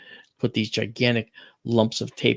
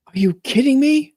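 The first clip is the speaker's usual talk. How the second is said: high, loud are you kidding me; mother's high shocked outburst; shocked disbelief; 13 semitones higher, 7 dB louder than usual. Retold or imitated. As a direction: gasp it out in astonishment. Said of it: "She goes".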